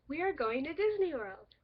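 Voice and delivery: loud, high, excited exclamation. Shown as a demonstration. Teacher demonstrating an exclamation mark, excited. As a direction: say it like big news you can't hold in. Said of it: "and the second one does".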